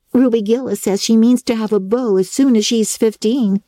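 put-on young Diana chatting brightly; lightly voiced young girl.

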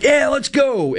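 high-pitched sports fan cheer; retold excited cry, pitched high; excited celebration. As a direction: cheer the goal, re-enacted. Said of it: "I'm just excited".

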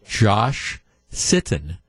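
Josh Sitton said very slowly, as two separate words; slow right down and clearly separate Josh from Sitton; "Josh... Sitton", each word carefully pronounced; careful enunciation. Shown as a demonstration. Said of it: "take a deep breath and slow down".

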